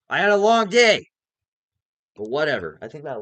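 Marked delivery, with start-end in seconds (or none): 0.1-1.1 much higher and louder complaint; annoyed outburst. Real, not acted. Vlogger venting to viewers that he's tired, loud outburst. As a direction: blurt it out, fed up. Venting while getting pissed.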